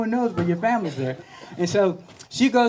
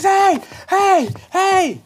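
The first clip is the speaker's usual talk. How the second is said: high, loud hey from the poolside; calling out; 13 semitones higher, 7 dB louder, much slower than usual. Retold or imitated. Coach calling to the swimmer from poolside, high and loud. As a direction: shout to get her attention.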